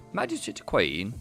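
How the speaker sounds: ordinary talk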